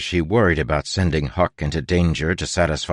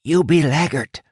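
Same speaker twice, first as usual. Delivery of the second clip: hissed; 10 semitones higher than usual. A narrator's reading. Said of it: "Huck hissed".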